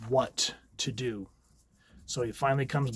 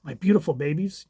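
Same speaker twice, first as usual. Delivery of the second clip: higher, louder desperate mother; Wanda pleading; 6 semitones higher, 6 dB louder than usual. Acted. Wanda clinging to her children in a higher, frantic plea. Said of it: "She goes".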